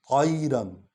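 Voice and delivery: طَيْرًا with a full, heavy ط. Shown as a demonstration. Teacher shows the heavy ط. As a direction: make the ط in طَيْرًا thick and full. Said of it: "توئے کو جو ہے موٹا کر کے پور پڑھنا ہے".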